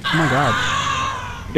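slow, stretched shout of disbelief; drawn-out disbelief. Real, not acted. Player shouting slowly at an enemy that won't die. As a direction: drag it out, can't believe it survived.

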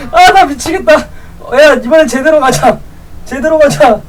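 usual speaking voice

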